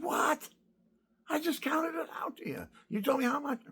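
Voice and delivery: What? shot up high in shock; indignant disbelief. Retold or imitated. Retelling the cashier's claim, incredulous high-pitched What. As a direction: retell the outrage of being shorted.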